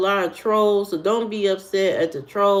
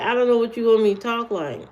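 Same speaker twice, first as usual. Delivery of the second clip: ordinary delivery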